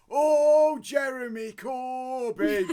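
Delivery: loud drawn-out cry of a name; mock rallying shout. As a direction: call it out like a protest chant.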